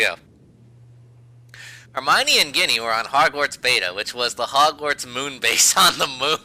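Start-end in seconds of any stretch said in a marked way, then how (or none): 1.9-6.4 bright mock-dramatic fanfic narration; story reading. Reading the fanfic aloud to her friend, mock-dramatic. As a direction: read it like a breathless fan story.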